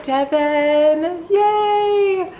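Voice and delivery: happy high squeal of thanks; excited cheer. Real, not acted. Thanking the giver happily.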